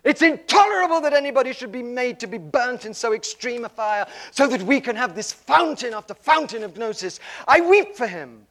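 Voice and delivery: loud anguished lament that peaks in a high shout; crying out. Retold or imitated. Ava wailing over Rumi's death, loud anguished cry. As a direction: rail against the suffering, near breaking. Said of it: "And she cried out".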